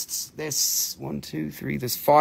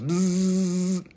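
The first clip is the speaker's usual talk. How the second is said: long imitated insect buzz; drawn-out bzzz; cicada buzz; 4 semitones higher than usual. Shown as a demonstration. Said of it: "and it goes".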